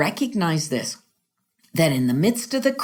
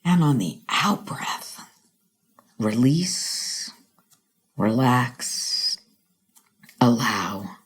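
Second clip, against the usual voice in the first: low, slow, fading to a whisper; guided meditation; 5 semitones lower, slower than usual. Shown as a demonstration. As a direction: breathe them out into stillness. Guided breathing.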